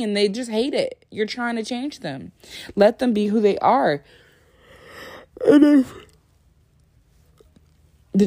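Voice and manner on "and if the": loud, high yawned words; talking through a yawn. Real, not acted. Vlogger starting her next point, overtaken by yawning. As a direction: let a big yawn take over the words. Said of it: "I'm yawning so much".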